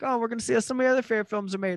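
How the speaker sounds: high, fast, peppy tour guide announcement. Retold or imitated. Tour guide greeting the tram riders, high and peppy. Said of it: "the tour guide".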